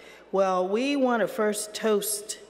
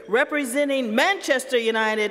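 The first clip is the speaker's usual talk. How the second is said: announcer build-up; 4 semitones higher, 4 dB louder than usual. Acted. Introducing the roaster over music.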